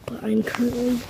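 whispering. Real, not acted. Hiding in closet.

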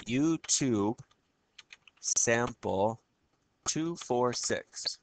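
spelled out slowly for students; slow dictation. Real, not acted. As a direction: say each number slowly and clearly.

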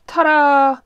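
high, loud showy ta-da; real relief turned into a fanfare; triumphant ta-da. Real, not acted. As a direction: announce it like a magic trick. Cover finally fits on.

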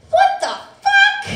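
put-on very high, loud kid yelling; child's shriek. Son screaming from the living room at his sister. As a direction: shriek it like an outraged little boy. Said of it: "all we hear coming from the living room".

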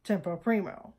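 tempo primo said softly in Italian; soft, careful tempo primo; correct Italian. Shown as a demonstration. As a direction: model tempo primo quietly, Italian vowels. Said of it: "it's pronounced".

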